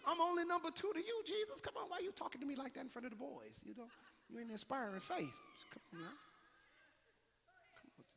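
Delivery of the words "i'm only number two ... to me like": Peter pleading. Acted. Peter voiced pleading with Jesus.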